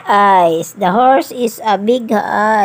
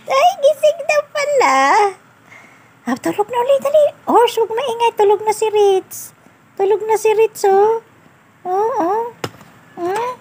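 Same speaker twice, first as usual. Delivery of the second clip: put-on high sing-song voice for a toddler; high baby talk; 11 semitones higher than usual. Caregiver lulling Ritz to sleep with the toy horse, high sing-song. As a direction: coo it up high like talking to a little one.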